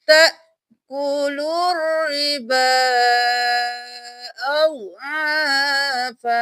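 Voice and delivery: loud, slow तअकुलु; Quranic recitation. Shown as a demonstration. Teacher reciting the joined reading. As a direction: chant तअकुलु रिबा with silent waw and alif. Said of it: "दोबारा देख लीजिए".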